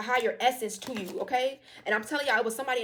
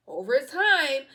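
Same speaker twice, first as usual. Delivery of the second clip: louder and very slow, stretching over time; drawn-out emphasis; 4 semitones higher, 8 dB louder, much slower than usual. Real, not acted. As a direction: lean on it like a preacher.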